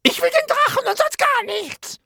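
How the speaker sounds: high, loud demanding witch; shrill witch, stamping her foot. Acted. (schrille Hexenstimme)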